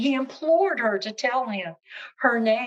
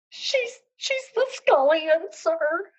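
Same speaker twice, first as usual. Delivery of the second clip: whimpering cook; 8 semitones higher, 5 dB louder, slower than usual. Acted. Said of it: "The cook began to whimper".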